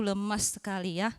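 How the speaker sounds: usual speaking voice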